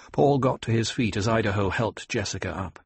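ordinary delivery, nothing marked

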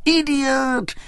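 high shout. A narrator's reading. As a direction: shriek it at him. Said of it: "shouted Maria again".

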